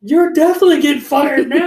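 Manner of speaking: high, loud acted-out threat; reenacted furious outburst; angry shout. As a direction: shout it as in a heated phone row. Acting out his phone quarrel.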